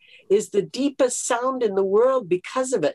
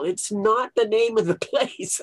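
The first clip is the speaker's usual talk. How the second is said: ordinary talk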